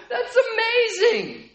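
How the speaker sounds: high, slow, laughing with a catch in the throat; overwhelmed happy cry, high and slow; laughing, near tears. Retold or imitated. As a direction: squeak out the joy through laughter and tears. Overjoyed convert's reaction.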